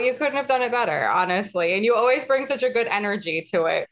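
ordinary talk, nothing marked

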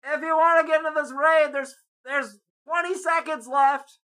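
high, far-off put-on voice of a raid guy; mimicking a pushy guy yelling across a park; mock yell. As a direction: call it over like a pushy stranger. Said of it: "kinda yells over at me".

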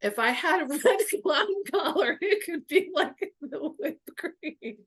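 put-on high, giggling through the boyfriend's words; can't get the line out for laughing; laughing quote. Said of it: "He goes".

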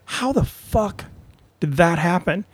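high, drawn-out incredulous question; mock disbelief. Retold disbelief at who gets booked.

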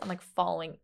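ordinary talk

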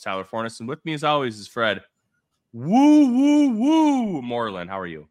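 ordinary delivery

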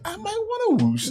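high-pitched mock voice; mock suggestion. Teasing friends about the whoosh, high mock voice. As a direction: pitch it well up, playfully imitating. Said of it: "It makes it sound like".

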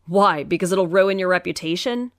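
usual speaking voice

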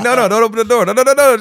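high loud frantic no no no; frantic shouting. Retold or imitated. Uncle reenacting yelling at the niece not to open the door. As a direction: yell it in mock panic.

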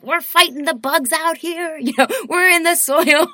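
high, loud, laughing voice of a feisty plant; playful tough food voice. Pretend. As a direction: play a scrappy little plant proud of its fight. Organic food voiced as a fighter.

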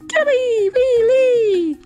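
high, loud monkey chatter; monkey screech. Acted.

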